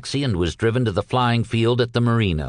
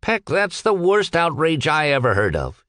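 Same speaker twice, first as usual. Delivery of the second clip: higher-pitched, emphatic outrage; indignant declaration; 6 semitones higher than usual. A narrator's reading. Captain hearing of Peck's impossible task, indignant declaration. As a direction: declare it as a scandal. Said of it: "he declared".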